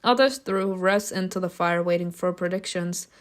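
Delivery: ordinary talk, nothing marked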